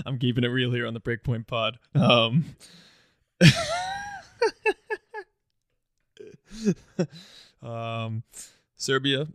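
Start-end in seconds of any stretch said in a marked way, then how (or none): 3.4-5.2 a sudden, very high burst of laughter; high squealing laugh. Real, not acted. Host cracking up at his own joke with a squealing laugh.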